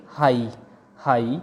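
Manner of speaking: "हाई" ending on the open vowel; silent gh. Shown as a demonstration. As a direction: say "हाई" with no g or h at the end. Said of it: "हम इसको इस तरह बोलेंगे".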